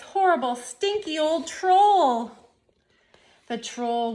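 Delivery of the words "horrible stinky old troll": loud and drawn out; loud, slow emphasis. A narrator's reading. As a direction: lean hard on it, slowly. Narrator relishes the troll.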